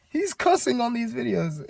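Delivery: high, fretful mom voice, imitating her; mock a panicking mother; worried mother. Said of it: "you're gonna be a nervous wreck".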